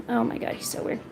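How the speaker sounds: soft whispered aside about the cat. Real, not acted. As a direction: breathe it out as a private whisper.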